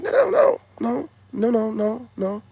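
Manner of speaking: very high, loud panicked no's; mock panicked no no no; frantic refusal. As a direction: squeal no while running off. Him refusing, fleeing.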